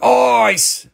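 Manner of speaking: hammed-up Aussie vowel, stretched out; bad Australian accent. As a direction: have a clumsy go at an Australian accent. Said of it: "I can't do your accent".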